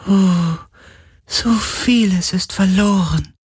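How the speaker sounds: hohe, langsame, schwache Geisterstimme; klagender Geist. Acted. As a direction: wie ein sterbender Geist klagen. Geist klagt. (hohe, traurige Geisterstimme)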